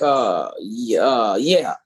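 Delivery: deep hesitant mumble; lightly voiced ex, low and halting; low mumbling ex voice. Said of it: "he was like".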